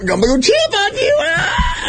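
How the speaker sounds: screeching high mock chimp yell; chimp noises. Drunk guy screeching like a chimp at the bouncer. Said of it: "why are you making, like, chupacabra noise".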